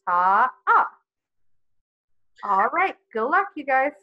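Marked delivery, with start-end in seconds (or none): 0.1-0.9 Ta-ah drawn out over two beats; held ta-ah. Shown as a demonstration. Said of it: "Would you say that with me?".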